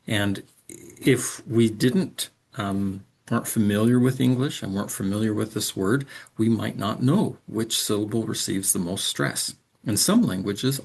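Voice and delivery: ordinary delivery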